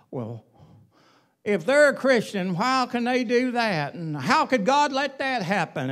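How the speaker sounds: mildly whiny mock of doubters; whiny complaint. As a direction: imitate a complaining churchgoer, pitiful. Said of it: "whiny, pitiful me song".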